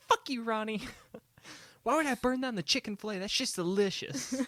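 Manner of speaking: high, loud comic impression; hammed-up indignant mall vendor shouting; Aziz Ansari. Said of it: "That sounded like Aziz".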